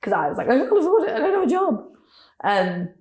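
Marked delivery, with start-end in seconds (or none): 0.5-1.9 upset plea. Retold or imitated. Retold distress to dad.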